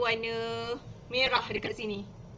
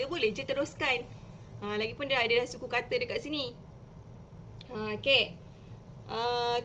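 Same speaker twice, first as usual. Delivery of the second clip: normal talking voice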